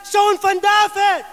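loud, high shout for help; crying out. Acted. Blind Bartimeus calls out to Jesus, shouting. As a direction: shout it out to be heard over a crowd. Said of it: "riep ik".

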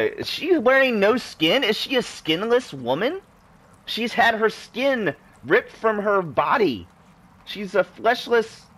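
high-pitched horrified astonishment; shocked disbelief. Real, not acted. Game player reacting to a skinless woman, shocked disbelief. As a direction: pitch shoots up, slowing as the horror sinks in.

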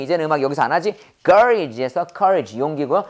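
normal talking voice

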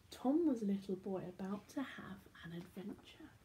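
secretive whisper; hushed, teasing whisper. Acted. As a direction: whisper the promise of adventure. Building suspense in story.

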